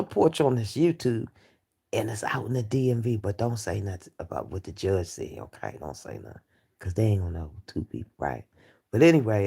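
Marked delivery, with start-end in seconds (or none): 1.9-8.4 hushed secret. Real, not acted. Keeping a celebrity secret.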